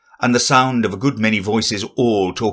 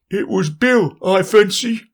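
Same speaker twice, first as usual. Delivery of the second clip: high character voice; 12 semitones higher than usual. Acted. Animal blaming Bill.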